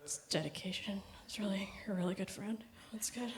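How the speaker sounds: hushed whisper, quick on the middle line; soft whispered fake praise. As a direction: whisper it like straining not to laugh. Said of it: "I had to just be like".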